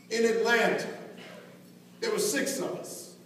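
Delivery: usual speaking voice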